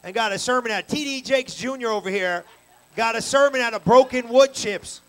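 loud, high, astonished exclamations; amazed exclaiming. Retold or imitated. Telling the church, loud and amazed. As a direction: marvel out loud at the preacher.

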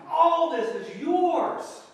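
very loud, high and slow, laughing through it; loud outcry. Acted. Father exclaiming to his grudging son, loud and slow. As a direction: belt it out slowly with a laugh. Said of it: "what he's saying to his son".

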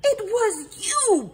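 high, slow, loud shout; shouted accusation. A narrator's reading. Revived possum accusing the detective, shouting. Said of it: "Perry shouted".

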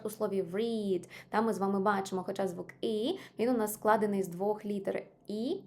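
normal talking voice

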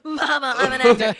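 loud, laughing mock proclamation; playful, overblown cry; mock dramatic declaration. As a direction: call it out hammily to your mother. Joke cry echoed between friends.

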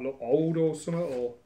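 ordinary delivery

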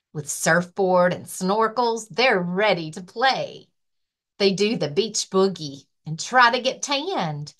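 lively, sing-song picture book reading; reading to children. Acted. Librarian reading a rhyming book to children, lively. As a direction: read it like story time for little kids. Library story time read-aloud.

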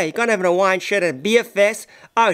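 normal talking voice

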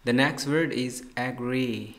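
slow teacher announcement; slow announcing. Shown as a demonstration. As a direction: announce the word slowly for the class. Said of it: "announces new word".

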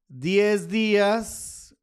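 very slow, each word stretched out; slow dictation while writing. Real, not acted. Writing on the chart for viewers, slowly drawn out. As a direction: draw the words out as you write them on the chart. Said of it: "y ahí dice diez días".

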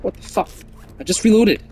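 loud, exasperated complaint; frustrated outburst. Real, not acted. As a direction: blurt it out in disbelief. Frustrated at dying after reload.

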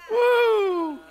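high, loud joyful whoop; joyful woo. Real, not acted. Preacher rejoicing in front of the church, high loud woo. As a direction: let out a big woo.

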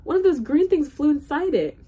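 high, loud, alarmed outcry; dismayed. A narrator's reading. Trumpeter explains his blocked horn, high dismay.

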